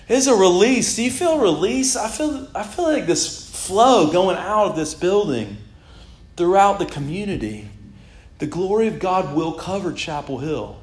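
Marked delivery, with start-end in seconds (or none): none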